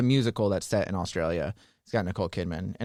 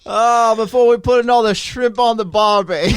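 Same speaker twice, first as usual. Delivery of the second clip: loud, high hammed-up Aussie with a laugh; a terrible tourist's Australian, laughing through it; bad Australian accent; 10 semitones higher, 8 dB louder than usual. Host joking about shrimp on the barbie in mock Aussie. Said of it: "So bad".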